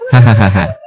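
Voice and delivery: short scornful ha-ha-ha; a taunting villain's laugh; mocking laugh. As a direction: laugh in his face.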